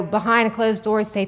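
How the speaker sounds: normal talking voice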